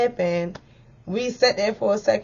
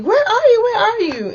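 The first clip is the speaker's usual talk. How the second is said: high, loud urgent repeated question; urgent calling; 6 dB louder than usual. Retold or imitated. Friends on the phone shouting anxiously for her location.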